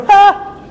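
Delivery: short, very high mock laugh; gleeful ha. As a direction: pounce on the prize with a squeal. Finder pockets the dollar gleefully.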